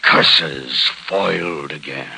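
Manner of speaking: mock villain. Villain's stock defeat line.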